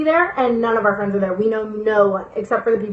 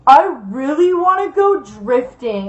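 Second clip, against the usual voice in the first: slow, lightly put-on driver voice; retold weird driver; slower than usual. Driver announces to his passengers, lightly put-on slow voice. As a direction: draw it out slowly, lightly imitating him.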